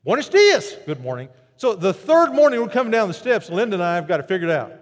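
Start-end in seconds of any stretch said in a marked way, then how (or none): 0.0-0.8 high loud Buenos dias; beaming greeting. Retold or imitated. Hotel attendant greeting guests, beaming and loud. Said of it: "lit up". 0.9-1.3 low, soft, sheepish Good morning; awkward mumble. Retold or imitated. Tourists answering the attendant, low and awkward. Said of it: "kinda awkwardly say".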